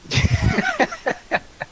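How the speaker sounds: high squealing laugh; high-pitched laugh. Real, not acted. Laughter at player's bluff.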